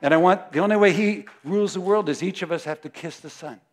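usual speaking voice

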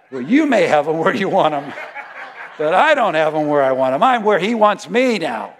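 loud, laughing complaint; laughing protest. Retold or imitated. To the Lord about the devil, loud laughing protest.

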